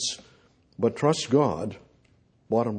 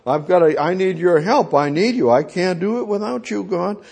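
louder, higher pleading prayer; urgent raised-voice plea to God; crying out to God; 6 semitones higher, 6 dB louder than usual. Acted. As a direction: cry for help as David, voice raised. Acting out David's cry to God.

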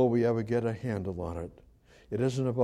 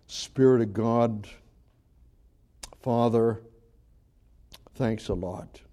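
closing prayer; 2 semitones lower than usual. Acted. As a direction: pray to close the sermon.

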